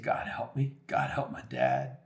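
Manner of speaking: soft, understated plea; retold tearful prayer. Son praying in his car, understated tearful plea. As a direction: pray quietly as if worn out from crying. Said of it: "crying and just saying".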